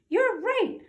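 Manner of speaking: high, loud squeaky cry; officer fleeing with his troops, squeaky yelp. Acted. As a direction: yelp it as you run after them. Big Cheese runs after troops.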